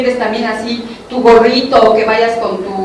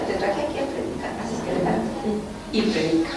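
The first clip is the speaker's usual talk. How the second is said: much softer, calm and steady; quiet voice of God giving an order; soft firm command; 3 semitones lower, 10 dB quieter than usual. Retold or imitated. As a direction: tell her gently but firmly to preach.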